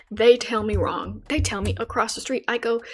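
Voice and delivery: ordinary talk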